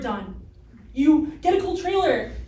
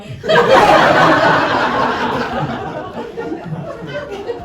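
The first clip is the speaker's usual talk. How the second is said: long, loud, high laughter; hearty laughter; 9 semitones higher, 10 dB louder than usual. Real, not acted. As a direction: burst out laughing. Laugh alone on a line.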